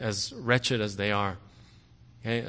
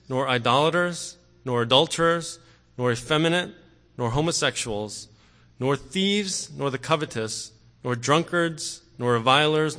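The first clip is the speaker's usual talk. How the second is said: rising, louder scripture reading building through the list; preaching scripture at full force; 4 dB louder than usual. Acted. Preacher reading Corinthians to the congregation, forceful and building. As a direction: drive each vice home to the congregation.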